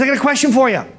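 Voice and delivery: high, eager cheery question; John Spanath. Retold or imitated. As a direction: pipe up with chirpy eagerness. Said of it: "he's all cheery".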